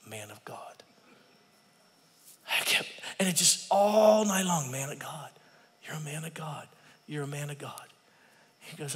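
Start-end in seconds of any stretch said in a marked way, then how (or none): 0.1-0.6 breathy whispered call in the dark; hushed whisper of a remembered voice. Retold or imitated. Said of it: "I heard you say". 4.7-7.9 hushed. Retold or imitated. Remembered voice echoing all night.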